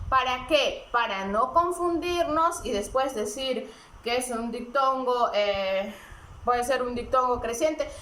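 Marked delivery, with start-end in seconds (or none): none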